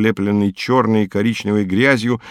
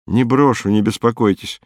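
ordinary delivery, nothing marked

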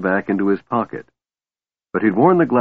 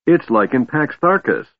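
higher-pitched, mildly breathless-sounding excitement; excited babbling; 6 semitones higher than usual. A narrator's reading. Tass spotting the dragons from the wall, babbling. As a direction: blurt it out in a high, eager voice. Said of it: "Tass babbled incoherently".

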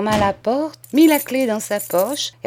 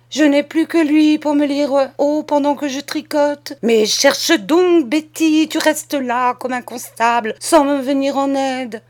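high, loud, exasperated scolding; reproche agacé; 7 semitones higher, 5 dB louder than usual. A narrator's reading. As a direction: berate the servant for standing idle. Flustered cousin scolds idle servant. (reproche agacé, d'une voix haute et forte)

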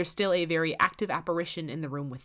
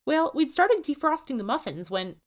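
higher, louder diner owner; Susan, cut off; 10 semitones higher, 6 dB louder than usual. A narrator's reading. Susan explains the morning, higher and louder. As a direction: rush it, then get cut off.

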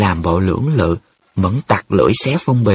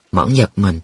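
usual speaking voice

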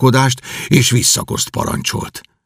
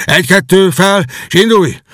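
higher, louder barked order; snapping orders; 8 semitones higher, 4 dB louder than usual. Acted. Kenty rouses his family with sharp commands. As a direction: hurry them out of bed. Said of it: "rájuk rivalt".

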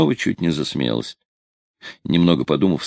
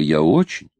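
higher, insistent second "очень"; stubborn; 4 semitones higher than usual. A narrator's reading. Girl insisting on her price to the sailor, stubborn. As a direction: dig in and insist. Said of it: "упрямо повторила девушка".

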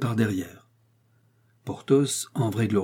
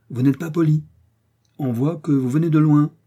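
raised, louder, cutting; cold rebuke; 5 semitones higher, 6 dB louder than usual. A narrator's reading. Athos haughtily rebuking the provincial young man. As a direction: put him in his place with scorn. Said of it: "dit Athos en le lâchant".

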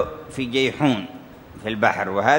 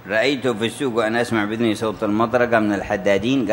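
ordinary delivery

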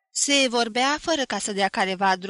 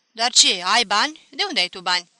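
louder, scornful doubting question; incredulous challenge; 9 dB louder than usual. A narrator's reading. Relatives challenging cousin George, loud and incredulous. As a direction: demand where the money comes from.

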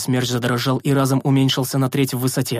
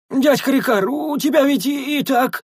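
much higher hesitant whine; whiny sentry; 12 semitones higher than usual. A narrator's reading. The sentry whines to Rikar, hesitant. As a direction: whine it timidly.

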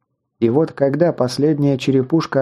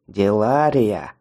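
голос некроманта; 3 semitones lower, slower than usual. Acted. Колдун произносит заклинание.

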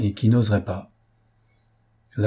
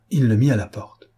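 plain reading voice

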